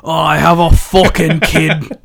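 loud, hammed-up Irish accent slipping into Scottish; Becky. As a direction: belt out a bad Irish accent. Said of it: "can I do Becky?".

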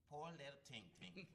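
slow, high comedian impression; Cat Williams. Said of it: "I can do Cat Williams".

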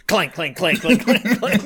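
imitated clanking. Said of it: "Vabrilla's just like".

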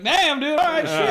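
loud, high exclamation; mock outburst. Acting out what he expected his friend to say, loud outburst. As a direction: blurt it out high and loud, laughing. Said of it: "I was like".

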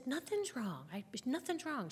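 high, lilting 'nothing's wrong'; sing-song denial. Retold or imitated. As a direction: insist in a high, falsely breezy voice. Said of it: "when we're, nothing's wrong".

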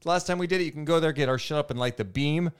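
loud, high sales hype; hyped promo read. Acted. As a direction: pump up the deal.

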